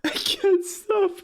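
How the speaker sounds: high, helpless laugh-crying, can't stop; laughing so hard the words turn to tears; crying with laughter. Real, not acted. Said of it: "I'm crying".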